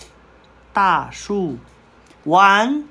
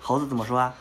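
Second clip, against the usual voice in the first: ordinary delivery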